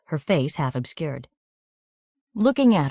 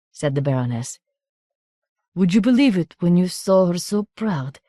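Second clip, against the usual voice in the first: coarse country voice; 2 semitones higher than usual. A narrator's reading. Baroness consoling the stranger in a coarse country accent. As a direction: relish the woman's downfall, coarsely.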